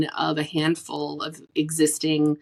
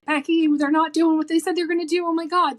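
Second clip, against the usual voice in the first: high, loud exasperated complaint; exasperated client; 11 semitones higher, 6 dB louder, faster than usual. Retold or imitated. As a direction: whine like a frustrated author. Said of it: "they're like".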